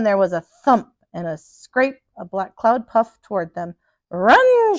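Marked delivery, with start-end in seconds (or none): 4.1-4.8 high, loud alarmed shout. A narrator's reading. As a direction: yell the warning to flee. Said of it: "shouted the captain".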